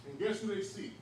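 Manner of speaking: ordinary talk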